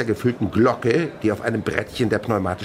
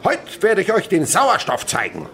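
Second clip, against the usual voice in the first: high, loud showman's announcement; proclaiming chemist; 9 semitones higher, 6 dB louder than usual. Acted. Chemist announcing the experiment to the pupils, loud and high. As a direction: play the grand lecturer.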